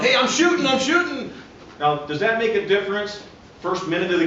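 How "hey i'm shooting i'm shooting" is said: high, pleading yell; players yelling. Retold or imitated. Player yelling at the referee that he was shooting, high yell. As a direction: shout for the foul call. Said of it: "the players are yelling".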